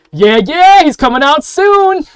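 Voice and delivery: loud, high, hyped-up onlooker shout; excited cheer. Acted. Air genasi in the crowd announces someone's arrival, excited shout. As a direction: whoop it out to the whole crowd.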